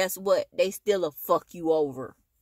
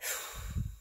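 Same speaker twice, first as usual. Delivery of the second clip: long tired breath out; weary sigh; 11 dB quieter than usual. Real, not acted. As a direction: let out an exhausted sigh. Tired venting to camera.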